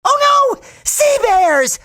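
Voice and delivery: high, panicked cartoon cry; comic alarm. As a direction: cry a warning in mock terror. Alarm at sea bears.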